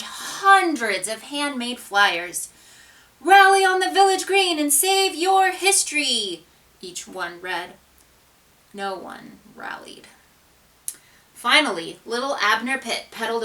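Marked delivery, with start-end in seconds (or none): 3.2-6.4 high, loud proclamation; rallying call. A narrator's reading. Flyer slogan read out. 8.7-10.1 hushed unvoiced whisper. A narrator's reading. Narrator drops to whisper.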